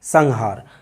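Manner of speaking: "संहार" said as sang-haar, with an ng; slow demo of "संहार" with ng before the h; velar nasal. Shown as a demonstration. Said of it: "सही उच्चारण है". ("संहार" में ङ की ध्वनि)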